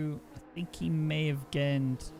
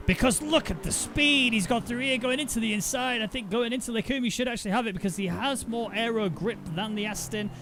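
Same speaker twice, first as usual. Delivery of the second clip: higher-pitched, running race commentary; excited play-by-play; 7 semitones higher than usual. Real, not acted. Commentator calling a battle through the corners, excited. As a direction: build suspense through the overtake without letting up.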